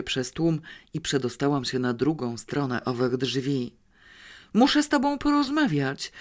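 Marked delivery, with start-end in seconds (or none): 4.5-6.1 desperate yell. A narrator's reading. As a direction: scream it to the cop to be saved. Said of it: "wrzasnęłam rozpaczliwie".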